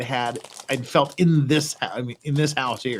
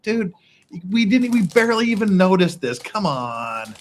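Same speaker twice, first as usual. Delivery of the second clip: exasperated disbelief; 6 semitones higher than usual. Retold or imitated. Mocking panicked Koreans.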